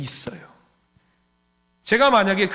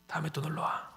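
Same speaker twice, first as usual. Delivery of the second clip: soft repeat; 13 dB quieter than usual. Retold or imitated. Repeated softly.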